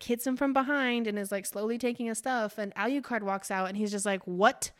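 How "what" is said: louder, slower outraged demand; angry. Retold or imitated. Alucard confronting Lila, loud and outraged. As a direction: catch her in the act, slowly. Alucard catches her robbing.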